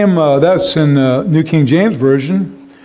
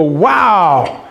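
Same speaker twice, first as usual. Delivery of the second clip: mock wow. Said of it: "you look, you go".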